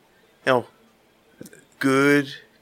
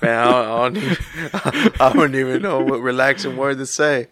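laughing; 5 dB louder than usual. Real, not acted. Nervous before whisper game.

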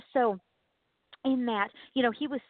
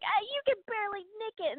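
mock taunt; 9 semitones higher than usual. Acting out a dunk tank thrower.

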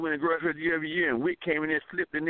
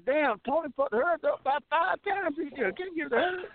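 imitated onlookers; 7 semitones higher, 6 dB quieter than usual. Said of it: "they gonna say".

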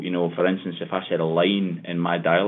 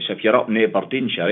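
ordinary talk, nothing marked

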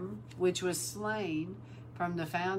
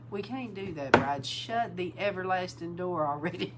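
ordinary delivery

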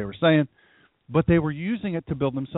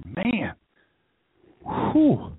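1 losing breath; 5 semitones higher than usual. Retold or imitated. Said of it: "you kinda lose your breath".

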